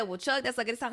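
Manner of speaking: normal talking voice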